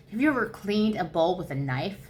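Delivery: usual speaking voice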